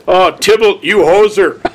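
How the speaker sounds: put-on comic Canadian hoser accent; Bob and Doug McKenzie. Co-host scolding Tybalt in a mock Canadian accent. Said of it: "turning into Bob and Doug McKenzie".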